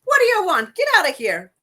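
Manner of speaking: high, loud mock-irritated dame; hammed-up annoyed retort; Mary Catherine Deneen. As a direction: send the visitor packing, in character. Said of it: "She's like".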